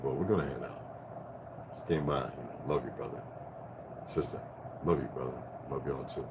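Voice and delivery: murmured goodbye to friends; quiet retold goodbye. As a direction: keep it low like a private talk. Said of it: "we was talking at such a low level".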